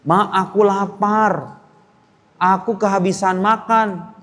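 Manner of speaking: slow, drawn-out hungry whine; whiny child. Retold or imitated. Santri phoning mother, slow pitiful hungry whine.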